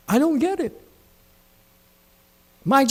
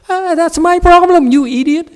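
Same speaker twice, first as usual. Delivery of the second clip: higher and louder, a scornful jeer; loud mocking retort; 13 semitones higher, 9 dB louder than usual. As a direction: bark it back at the complaining student. Master mocks the student's excuse.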